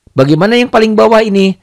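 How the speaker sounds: usual speaking voice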